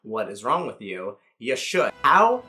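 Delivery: ordinary delivery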